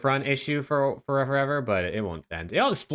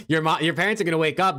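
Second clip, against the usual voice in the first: ordinary talk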